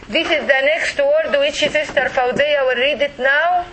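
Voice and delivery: ordinary talk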